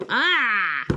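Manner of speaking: exasperated "ah" breaking off the sentence; frustrated cry. Real, not acted. Reader hating the trope, frustrated cry. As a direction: cry out in annoyance. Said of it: "so mad".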